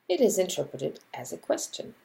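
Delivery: normal talking voice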